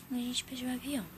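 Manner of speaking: usual speaking voice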